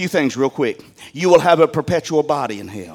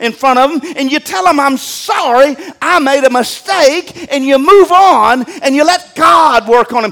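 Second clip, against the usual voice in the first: emphatic preaching; 11 semitones higher, 9 dB louder than usual. Acted. Preaching about raising kids.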